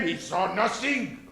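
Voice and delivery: ordinary talk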